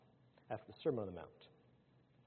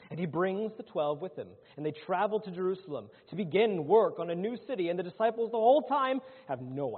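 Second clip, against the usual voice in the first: raised, loud pulpit storytelling; forceful preaching; 6 semitones higher, 7 dB louder than usual. Acted. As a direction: tell it to the congregation with mounting force.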